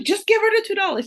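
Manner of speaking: amused, rushed urging; exasperated plea. Retold or imitated. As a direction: beg her to just pay up, laughing inside. Mother urging grandma, half laughing.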